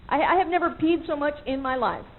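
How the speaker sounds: usual speaking voice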